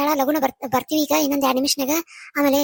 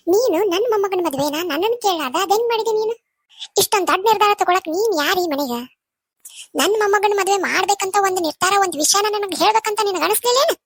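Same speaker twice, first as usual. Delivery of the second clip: raised pitch, indignant scolding; angry confrontation; 4 semitones higher than usual. Acted. Grandmother confronting the one who married off her grandson, indignant. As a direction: demand answers as the affronted elder.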